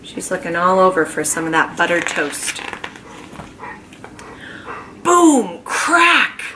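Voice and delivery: usual speaking voice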